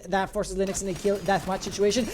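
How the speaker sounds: building hype, rising high and loud; excited. Real, not acted. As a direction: caster climbing to a big finish. Final clutch moment of the round.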